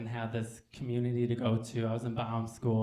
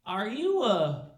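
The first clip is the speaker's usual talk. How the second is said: slow, deliberate, higher question; Chevy; 4 semitones higher, 3 dB louder, slower than usual. Acted. Chevy turns back with one more question, slow and deliberate. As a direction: draw out each word of the question. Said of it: "and he says".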